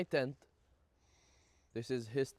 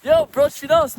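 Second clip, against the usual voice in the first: much higher, loud hype; excited; 12 semitones higher, 14 dB louder than usual. Real, not acted. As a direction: shout the thanks with joy. Excited thanks for gifted subs.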